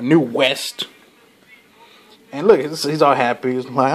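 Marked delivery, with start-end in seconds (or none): none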